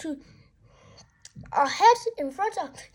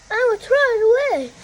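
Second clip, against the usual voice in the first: hurried excited line; 5 semitones higher, 5 dB louder, much faster than usual. Acted. Character's panicked retort.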